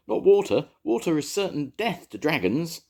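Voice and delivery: high, loud urgent warning; frightened dragon. A narrator's reading. The dragon warning Di that water kills dragons, alarmed. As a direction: plead in alarm. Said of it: "shouted the dragon".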